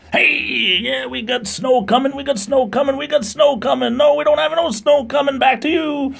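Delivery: very high squeaky forecaster; JJ the weather reporter. Acted. DJ hands over to the weather, squeaky excited JJ voice. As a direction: squeal it out far above your normal pitch. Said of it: "shoot over to the weather with J J".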